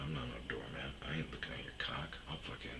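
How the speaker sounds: low, soft muttered threat; quiet seething mutter. Retold or imitated. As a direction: mutter it under your breath.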